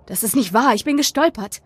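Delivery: insistent protest climbing in pitch; defensive denial. A narrator's reading. Boy accused of pushing his friend, defensive. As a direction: protest your innocence, voice rising.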